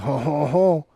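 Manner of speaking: jokey Frenchman's "ho ho ho"; mock French accent. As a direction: put on a cartoon French laugh. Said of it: "That's French and Europe".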